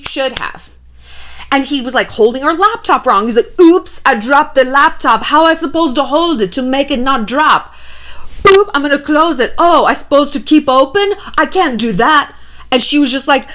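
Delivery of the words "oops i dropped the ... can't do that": higher, taunting mock European voice; general European accent. Boyfriend sabotaging her laptop, fake-innocent European accent. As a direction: play clueless in a hammed-up European accent. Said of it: "I don't even know what this accent is, it's just, like, general Europe".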